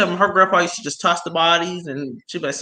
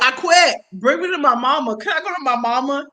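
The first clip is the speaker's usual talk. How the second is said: mock scared; 7 semitones higher than usual. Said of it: "I was like".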